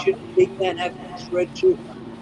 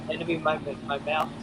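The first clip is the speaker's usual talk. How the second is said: normal talking voice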